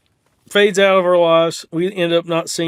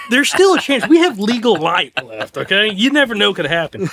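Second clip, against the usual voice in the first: hopeful pep talk; 5 semitones higher, 4 dB louder than usual. Retold or imitated. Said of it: "and I'm like".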